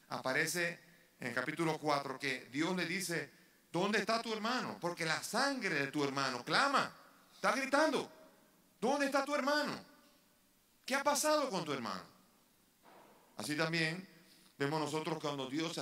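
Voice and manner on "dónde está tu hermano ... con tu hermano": raised, high-pitched, impassioned questioning; God confronting Cain. Acted. Preacher quotes God asking Cain about his brother, loud confrontation. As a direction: demand it loudly, as an outraged God.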